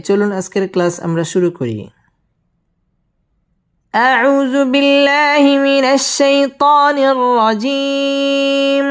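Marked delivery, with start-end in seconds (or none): 3.9-8.9 slow, high melodic tilawah; Quran recitation. Shown as a demonstration. Teacher opening the lesson with slow recitation. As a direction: chant it slowly like a reciter.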